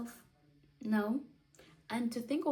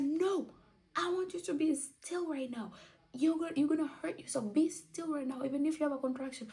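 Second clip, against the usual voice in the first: higher, fast imitating doctor's yell; mock yell; 8 semitones higher than usual. Doctor yelling at her to stay still, mock yell. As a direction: snap the order, pitched up. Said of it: "yelled at me".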